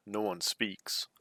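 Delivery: ordinary delivery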